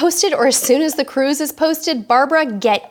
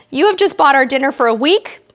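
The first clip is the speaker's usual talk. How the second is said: normal talking voice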